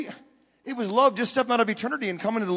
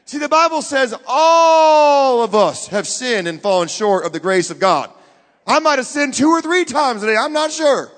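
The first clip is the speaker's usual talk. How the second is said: loud, raised preaching with ALL stretched long; emphatic preaching; 3 semitones higher, 7 dB louder than usual. Real, not acted. Preacher on everyone falling short, emphatic and loud. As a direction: drive it home loud and high.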